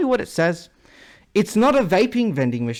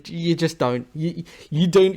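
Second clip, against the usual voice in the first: ordinary talk, nothing marked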